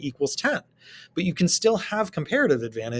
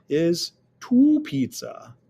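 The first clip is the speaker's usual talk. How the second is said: drawn out; 3 semitones higher, 4 dB louder, much slower than usual. Shown as a demonstration. Emphasizes answer slowly.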